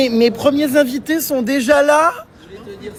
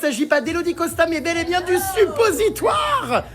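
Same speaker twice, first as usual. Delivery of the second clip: game-show reveal; 4 semitones higher than usual. Pretend. Mock TV casting introduction.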